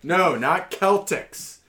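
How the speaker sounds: slow, high, frustrated correction; exasperated correction. Pretend. Arguing with a phone menu.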